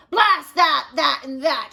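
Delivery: loud, hammed-up shout; angry shout. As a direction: blast the words out in frustration. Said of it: "shouted President Taft".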